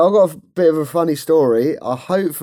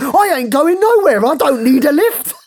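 high, loud, laughing refusal; indignant worker; 12 semitones higher, 5 dB louder than usual. Retold or imitated. Host voicing the worker refusing a lift, high and loud. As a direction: refuse the lift in a shrill huff. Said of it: "He'd be like".

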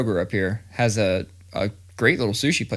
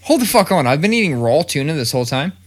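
higher, louder disbelief; mock shock; 5 semitones higher, 5 dB louder than usual. Dad learning spicy tuna is raw, loud higher mock shock. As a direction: blurt it like a sudden realization. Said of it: "I was like".

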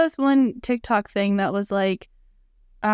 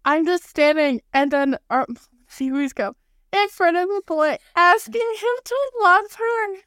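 rom-com quote voice; 7 semitones higher than usual. Retold or imitated. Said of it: "sometimes I'll be like".

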